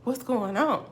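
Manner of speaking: puzzled, still holding it in; retold bewilderment. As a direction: act out confused disbelief. Retold shock at right guesses.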